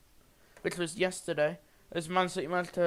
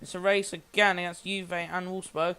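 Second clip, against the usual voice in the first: live match commentary, brisk and lively; play-by-play commentary. Acted. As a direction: call every pass as it happens. Live football game commentary.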